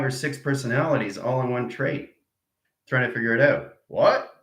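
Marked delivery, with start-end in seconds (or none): 3.9-4.3 high, louder startled what. Acted. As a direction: snap out a surprised what. Personalities arguing.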